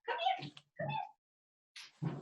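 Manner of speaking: very high soft dog call. Real, not acted. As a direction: coax the dog in a high whisper. Calling the dog again.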